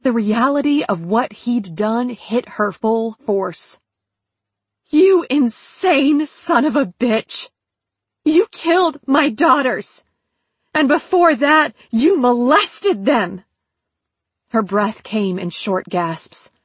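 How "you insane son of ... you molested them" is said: furious, raised voice, higher pitch; angry accusation. A narrator's reading. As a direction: hurl the accusation at the killer. Mother screams at her daughters' killer.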